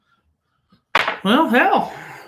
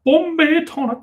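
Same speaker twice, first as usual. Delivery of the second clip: mock Indian accent; 11 semitones higher than usual. Continues accented ad read.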